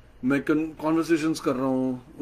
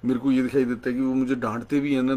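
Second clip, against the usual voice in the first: ordinary talk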